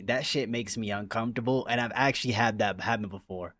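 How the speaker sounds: ordinary talk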